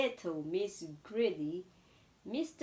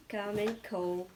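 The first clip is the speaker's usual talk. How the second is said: usual speaking voice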